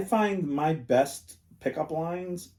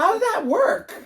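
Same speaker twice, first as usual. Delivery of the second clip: amazed question; 7 dB louder than usual. Retold or imitated.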